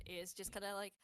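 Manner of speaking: ordinary talk, nothing marked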